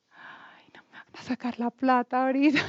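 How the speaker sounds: tonlos geflüstert, als heimlicher Gedanke; flüstere den Verdacht für dich selbst; whispered thought. Retold or imitated. Private thought at the church door.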